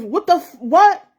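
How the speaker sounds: shocked, rising mock outrage; imitating a shocked mom; mom's shocked reaction. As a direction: play the mom's loud disbelief. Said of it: "she was".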